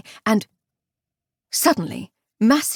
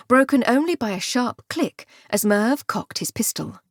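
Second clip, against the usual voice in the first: usual speaking voice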